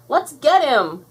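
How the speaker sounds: loud, high battle cry; rally them to charge; calling out. A narrator's reading. Buttercup urging her sisters after the monster, calling. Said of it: "Buttercup called to her sisters".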